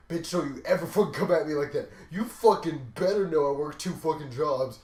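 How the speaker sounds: loud furious retort, pitch up; angry snap at coworker. Retold or imitated. [name] snapping at a coworker who asks to swap shifts, furious loud retort. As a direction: yell it right back at him, furious. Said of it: "And I'm like".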